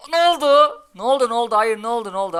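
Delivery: high, loud disbelieving cry; shocked shout. Real, not acted. Streamer watching his troops die, loud shocked disbelief. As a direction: yell it like you can't believe your eyes. Sudden bad turn in the match.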